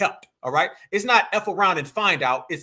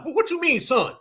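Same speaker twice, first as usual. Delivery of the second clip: higher, playing dumb about the sun; mock feigned ignorance; 8 semitones higher than usual. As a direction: act baffled like a politician in denial. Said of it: "You be like".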